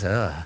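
low acted groan of exhaustion; weary groan. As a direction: groan low like a worn-out body. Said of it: "My body was".

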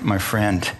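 ordinary talk, nothing marked

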